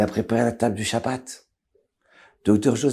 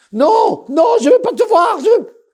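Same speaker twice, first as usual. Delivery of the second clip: very high, loud cries of refusal; panicked shouting; 9 dB louder than usual. Acted. Son shouts at the doctor entering his room. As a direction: scream him away in panic.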